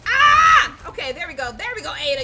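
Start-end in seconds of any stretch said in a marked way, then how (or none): 0.1-0.9 high, very loud yelp; real scream at a sudden hit; startled scream. Real, not acted. Player suddenly hit in game, startled scream.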